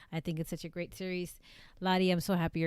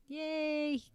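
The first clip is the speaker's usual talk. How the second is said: high, drawn-out yay; happy cheer; 9 semitones higher than usual. Real, not acted. Streamer celebrating flying back Saturday for two months, happy high cheer. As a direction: cheer it in a held high pitch.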